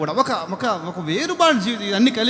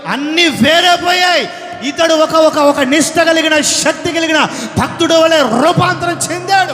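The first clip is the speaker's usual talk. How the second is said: higher and louder, ending in shouts; full-force preaching; 5 semitones higher, 6 dB louder than usual. Acted. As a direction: hammer each sin, then cry out.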